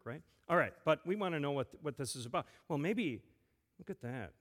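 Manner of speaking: usual speaking voice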